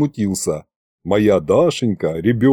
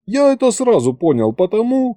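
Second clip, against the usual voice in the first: usual speaking voice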